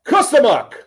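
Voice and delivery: high, loud punchy delivery; emphatic. Shown as a demonstration. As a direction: bark the word out with emphasis. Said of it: "It's perfect".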